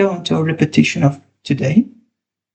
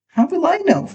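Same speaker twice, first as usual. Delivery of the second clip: indignant pupil; 11 semitones higher than usual. Acted. Pupil protests.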